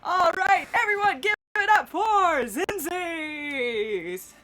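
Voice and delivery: loud, high, drawn-out hype; booming announcer. Acted. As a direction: whip the crowd up, stretching the name. Groundhog announcer whips up applause.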